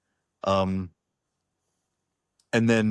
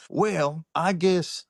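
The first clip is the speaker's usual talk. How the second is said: high imitated neighbor voice; drawn-out, high-pitched impression of a woman; Jody McGinnis; 8 semitones higher, much slower than usual. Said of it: "And she goes".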